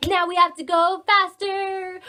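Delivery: loud, sung announcement; excited sing-song. Acted. Teacher urging children to speed up, sung out loud. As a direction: sing it out to rev up the kids.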